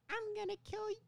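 very high squeaky cartoon boy; cartoon kid threat. High Timmy Turner voice in a retelling.

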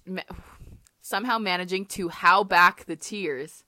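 ordinary talk, nothing marked

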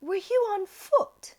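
high, slow posh British mum; posh British accent. Retold or imitated. Mom questioning the breathless stranger, mock posh British accent. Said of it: "have the poshest British accent".

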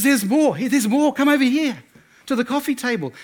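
high, excited boyfriend impression; eager excitement. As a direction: urge her over, loud and high. Boyfriend eagerly shows off more.

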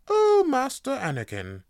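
high, prim robot butler voice; C-3PO. Retold or imitated. Robot butler greeting his young master, prim and high. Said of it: "who doesn't want a C three P O?".

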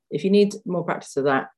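normal talking voice

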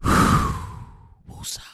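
long whispered exhale into "woosah"; calming sigh. Real, not acted. Host calming himself after the Giants loss, whispered sigh. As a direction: breathe out the anger slowly. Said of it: "I'm trying to woosah".

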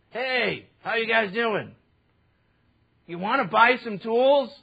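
thief's sales pitch. Retold or imitated. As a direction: sell them tools like a shady street vendor. Thieves hawking stolen tools.

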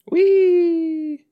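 very high loud whee; high delighted whee; gleeful squeal. Retold or imitated. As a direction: squeal with joy. Voicing swinging character.

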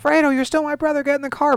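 very high, strained mock-dramatic plea; dramatic Michael. Michael urges his brother into the car, mock-dramatic high voice. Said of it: "it's, like, very dramatic. He's like".

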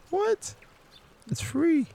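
squeaky high disbelief; high-pitched what; mock surprise. Mock surprise at breathing wrong.

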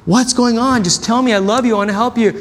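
lightly voiced exasperated father begging his son to open up; exasperated dad. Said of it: "My dad would be so exasperated".

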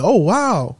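imitated impressed surprise; amazed. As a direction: react as the employee stunned by the amount. Employee sees the cheque amount.

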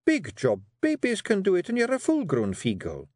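high Scots wifely voice; a firm wee wife steering her man; Jeanie coaxing. Acted. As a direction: Jeanie urging her husband to write.

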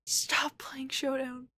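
slow, breathless whisper. Real, not acted. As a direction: plead it under your breath, slowly. Begging friend to stop gaming.

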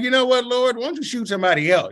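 mock indignant pitch jump, rushed tail; sassy retort. Speaker jokingly pushing his calling onto someone else, cheeky. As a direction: talk back to God, half joking.

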